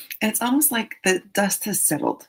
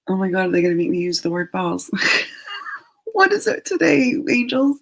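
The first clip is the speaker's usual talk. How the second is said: laughing disbelief; 4 dB louder, faster than usual. Real, not acted.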